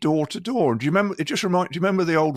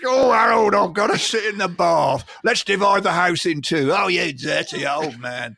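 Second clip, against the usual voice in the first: Trump as Steptoe; slower than usual. Retold or imitated. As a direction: drag it out like a fed-up old man. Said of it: "Can you imagine him addressing this".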